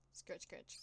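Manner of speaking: unvoiced soft scratching noise; whispered scritch. Narrating a dark alley, a whispered scurrying noise. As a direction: breathe out the scritch scritch like tiny claws.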